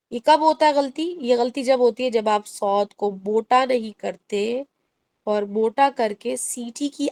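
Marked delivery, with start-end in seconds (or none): none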